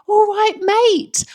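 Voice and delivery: slow, very high, loud London greeting; delighted echo. Retold or imitated. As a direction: savour the words like a long-lost friend. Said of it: "I was like".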